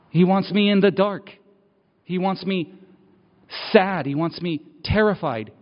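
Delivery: rising passionate testimony; building intensity. Real, not acted. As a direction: preach it harder and harder toward the end.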